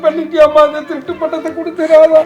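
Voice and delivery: loud, high wailing complaint; tearful lament. Acted. Uncle to niece who made him cry, wailing. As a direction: lament loudly through tears. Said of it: "அழுவுற மாதிரி பண்ணிட்டியாம்மா".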